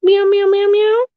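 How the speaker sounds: high mock cat meows. Owner meows at her cat Luna, high mock meowing. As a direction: meow up high like a kitten.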